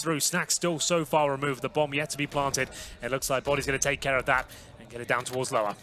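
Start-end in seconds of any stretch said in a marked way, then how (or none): none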